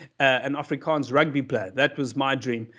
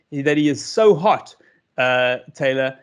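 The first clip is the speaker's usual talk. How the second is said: usual speaking voice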